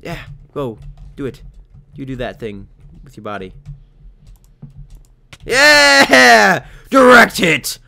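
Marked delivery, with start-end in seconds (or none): 5.5-7.8 high, loud drawn-out yell; triumphant cheer. Real, not acted. Player landing a hit, triumphant yell. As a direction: whoop in triumph.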